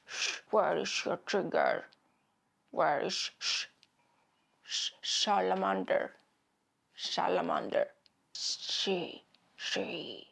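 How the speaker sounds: low, whispery lisping Batman drill; lisping Batman practicing. Retold or imitated. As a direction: lisp it out low like a hoarse Batman rehearsing in the mirror. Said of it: "going like".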